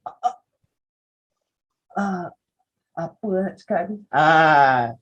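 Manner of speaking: halting, lost-for-words stammer; mock blank-minded presenter, stuck; blacking out. Shown as a demonstration. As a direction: grope for words with long empty gaps.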